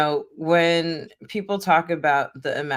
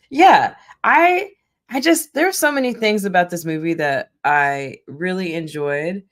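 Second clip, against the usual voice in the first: usual speaking voice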